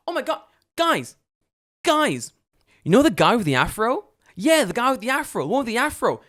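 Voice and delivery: put-on high, loud, excited child voice; screaming little girl. Little girl telling friends where the neighbour lives, high yelling. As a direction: mimic a kid announcing gossip to the whole street. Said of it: "This raggedy bitch is screaming".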